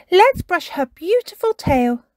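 put-on high, loud child character voice; Peppa, eager. A narrator's reading. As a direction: suggest it keenly, pitched well up. Peppa eagerly suggests next game.

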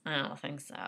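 ordinary talk

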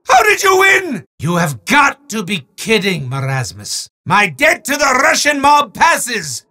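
booming sore-loser wizard, shouting his protests; a theatrical villain ranting at the player; hammy evil wizard, outraged then mocking; gloating wizard villain.

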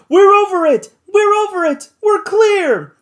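very high, loud triumphant shout; exulting cry. A narrator's reading. Joe shouting to the crew they cleared the peak, exultant. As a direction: yell it in pure relief and triumph. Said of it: "cried out an exulting voice".

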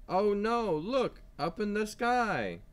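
put-on high, loud oh no for young listeners; surprised alarm. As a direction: sound the alarm at the rain clouds for the kids. Rain appears in the story.

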